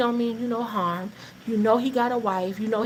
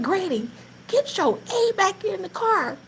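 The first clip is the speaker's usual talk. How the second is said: high, urgent mock yell at granny; retold yell; 10 semitones higher than usual. Narrator yells at grandma to get in the car. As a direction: snap at granny to hurry. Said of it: "only time I ever yelled at my grandmother".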